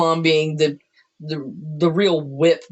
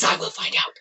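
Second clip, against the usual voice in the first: unvoiced menacing whisper; whispered threat; 5 dB quieter, faster than usual. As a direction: breathe the threat quietly. Mom voiced, menacing.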